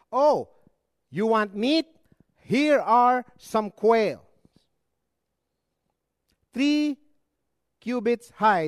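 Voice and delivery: high, loud mocking offer; sarcastic God. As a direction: voice God handing over the quail in scorn. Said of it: "He answered their prayers".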